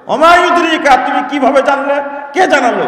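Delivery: high, loud demanding question; loud, high grave question; questioning angel. Retold or imitated. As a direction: voice the angel interrogating the dead. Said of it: "জিজ্ঞেস করবে".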